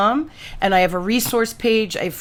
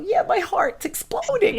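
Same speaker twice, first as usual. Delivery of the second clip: gushing; 12 semitones higher, 4 dB quieter than usual. Retold or imitated. Quoting herself to husband.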